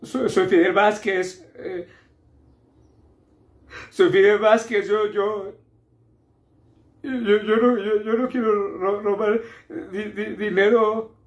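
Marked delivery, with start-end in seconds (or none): 3.9-11.1 high, whining, sobbing protest; tearful stammer. Acted. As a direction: stammer through tears, voice pitched way up. Tearful protest about stolen money.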